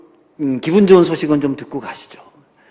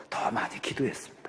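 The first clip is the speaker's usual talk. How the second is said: much softer, whispered and low; 5 semitones lower, 15 dB quieter than usual. Acted. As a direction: let it fade into a whisper. Listing the parents' love. (아주 작게 속삭이며)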